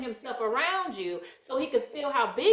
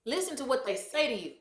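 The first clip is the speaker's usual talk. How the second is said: usual speaking voice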